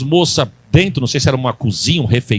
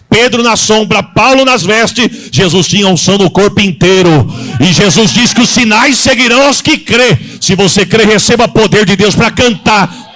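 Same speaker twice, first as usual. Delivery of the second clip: loud, driving pulpit cadence; rousing the church to receive God's power; full-force preaching; 4 semitones higher, 6 dB louder than usual. Acted. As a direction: preach it hard to the congregation.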